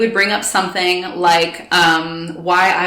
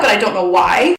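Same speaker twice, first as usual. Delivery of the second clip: usual speaking voice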